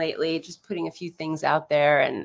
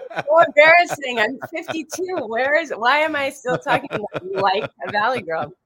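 laughing embarrassment; 9 semitones higher, 6 dB louder than usual. Real, not acted.

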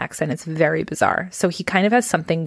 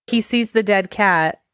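ordinary talk, nothing marked

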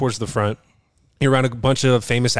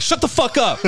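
mock snapped retort; 13 semitones higher than usual. Retorting to teasing players.

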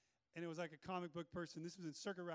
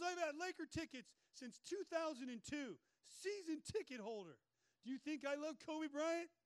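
higher, edgy retort as the doctor; 7 semitones higher than usual. Retold or imitated. Doctor bristling at the pastor's question, edgy and higher. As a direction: play the gruff doctor with an edge. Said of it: "still a little bit of an edge".

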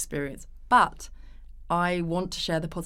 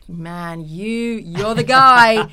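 slow, loud, higher blokey back-slap; hamming up a matey cheer; mock cheering a husband as a hero; hearty mock praise; 4 semitones higher, 12 dB louder, much slower than usual. Mocking praise given to husband.

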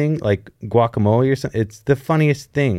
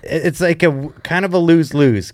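ordinary talk, nothing marked

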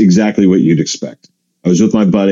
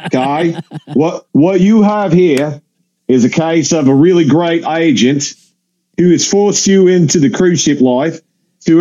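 higher, emphatic mock Aussie; Australian accent; 5 semitones higher than usual. Australian passenger critiquing a young comedian, mock Australian accent. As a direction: lecture bluntly in a comic Aussie voice. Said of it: "I apologize to my Australian listeners".

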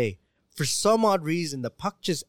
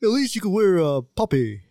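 put-on Canadian accent; 5 semitones higher, 5 dB louder than usual. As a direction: stretch it like a yawning Canadian. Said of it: "Sounds like he's trying to yawn and talk".

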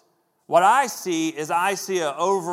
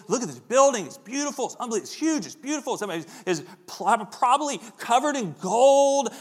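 much higher, breathless wonder; wide-eyed awe at a huge building; gushing disciples; 6 semitones higher than usual. Retold or imitated. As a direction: rave about the building like tourists. Disciples gushing over the temple.

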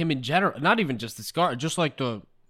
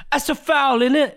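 louder, higher mock British fan yelling; 12 semitones higher, 5 dB louder than usual. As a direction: yell it like a British football fan at a ref. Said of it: "screaming out, like, British slang".

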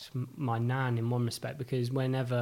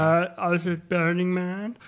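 stoner; 6 semitones higher, 6 dB louder than usual. Retold or imitated. Repeats the Musk impression.

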